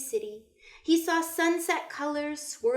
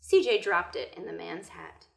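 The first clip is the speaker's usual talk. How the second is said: ordinary delivery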